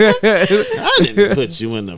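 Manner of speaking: slow, high, laughing mock innocence; playing dumb. Friend mimics him denying the friend zone, playing dumb. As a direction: act clueless through a laugh. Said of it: "the time he plays dumb".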